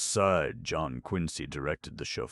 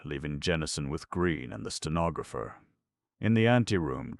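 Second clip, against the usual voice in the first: plain reading voice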